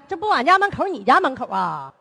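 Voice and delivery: ordinary talk, nothing marked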